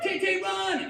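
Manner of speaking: shrieked getaway call; urgent high yell. Acted. Robber to his imagined friend fleeing the store, urgent high yell. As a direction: yell it high and loud, drawn out.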